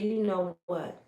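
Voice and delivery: soft, deadly even threat; a mother calmly threatening a rival; low calm menace. Retold or imitated. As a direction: keep it low and cold. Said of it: "she said".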